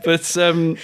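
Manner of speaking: ordinary talk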